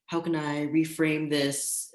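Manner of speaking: usual speaking voice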